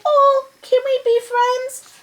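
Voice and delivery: high-pitched, pleading mouse voice; little mouse. Acted. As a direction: ask hopefully to be friends. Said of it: "So Mouse is like".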